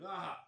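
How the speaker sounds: loud high ah as he finds it; a sudden pleased cry of finding it; triumphant ah; ah of discovery. Acted. NPC finds item in pack.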